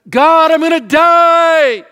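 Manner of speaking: high, loud, slow panicked cry to God. Pretend. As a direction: burst out in mock panic, drawn out. Panicked worrier crying out.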